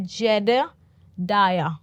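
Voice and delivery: "जेडेडाया" said slowly as jeh-deh-DAI-ya; "जेडेडाया" as a slow teacher's model; pronunciation demo. Shown as a demonstration. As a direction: draw out "जेडेडाया" syllable by syllable, ending on a fall. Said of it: "इसका pronunciation क्या है?".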